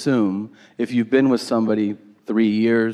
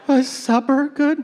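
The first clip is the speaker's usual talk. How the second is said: high-pitched mock wife voice; poor mother; 12 semitones higher than usual. Mother to grumpy dad in bed, high meek voice. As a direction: ask timidly, high, as the wife.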